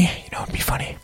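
hushed, voiceless whisper. Pretend. Host floating a funny idea to listeners, whispered. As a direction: whisper it as if sharing a secret.

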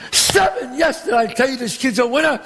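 excited cheer. Acted. Gambler bragging on the kid after a seven, excited cheer. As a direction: crow over a winning throw. Celebrates the winning throw.

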